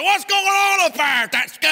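angry shout. Retold or imitated. Angry caller shouting at the pastor on the phone, loud demand. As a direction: shout it like an angry church member.

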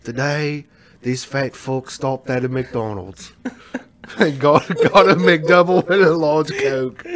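a hint of a documentary narrator, slow and drawn out; David Attenborough. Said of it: "I'd go David Attenborough".

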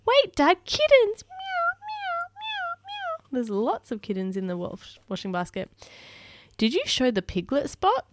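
animated picture-book reading; reading to children. Acted. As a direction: read it like bedtime for little ones. Reads picture book aloud.

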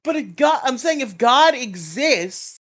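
hammed-up angry atheist rant; mock edgy rant. Mock edgy rant about God.

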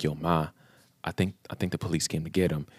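low, very soft aside to mom; hushed confiding. Retold or imitated. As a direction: break it to her quietly. Retold confiding in his mother.

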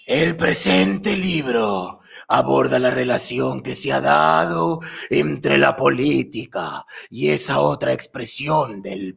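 slow, lightly voiced sorcerer reading; wizard voice. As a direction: read it like an old warlock, slowly. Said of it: "Voy a hacer una voz de brujo". (voz de brujo lenta)

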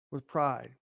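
ordinary delivery

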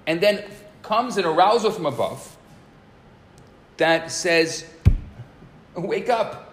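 loud, high, impassioned sermon voice; fervent preaching. Acted. Rabbi preaching to listeners about the starving soul, fervent and loud. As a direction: preach it to the room with fire.

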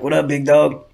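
a light take on the rapper welcoming his buddy in. Retold or imitated. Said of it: "I'll do the voice".

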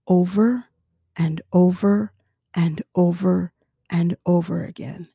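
usual speaking voice

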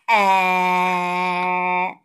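long level ah for the doctor; saying ah at the doctor; open-mouth ah. A narrator's reading. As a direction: hold a steady ah, mouth wide. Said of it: "abre la boca bien abierta".

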